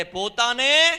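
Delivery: rally the crowd to shout along; loud call-and-response. Real, not acted. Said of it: "જોરથી બોલો મારી સાથે".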